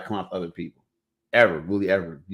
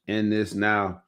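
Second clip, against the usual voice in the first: ordinary delivery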